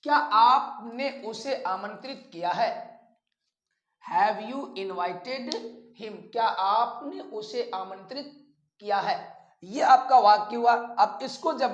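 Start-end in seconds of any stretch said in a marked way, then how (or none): 4.0-6.2 slow model reading. Shown as a demonstration. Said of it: "teacher repeats sentence as model".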